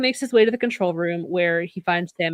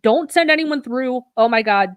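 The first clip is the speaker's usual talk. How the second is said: higher, louder pleading alarm, lightly acted; mock urgent warning; 5 semitones higher, 5 dB louder than usual. Podcaster voicing Sam trying to warn the team, mock urgency. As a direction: imitate a frantic call to stop them.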